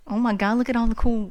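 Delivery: ordinary talk, nothing marked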